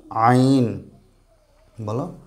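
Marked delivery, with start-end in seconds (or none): 0.1-0.9 আইন squeezed from mid-throat, long vowel; pressed throat sound. Shown as a demonstration. Teacher models Arabic letter, pressed throat sound. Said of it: "চাপ দিয়ে পড়তে হবে".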